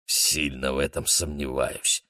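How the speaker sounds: low, slow, sceptical grumble; grumbling. A narrator's reading. Cook Джихон doubts the shaman's ritual, grumbling. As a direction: grumble it quietly, dragging the words. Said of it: "буркнул Джихон".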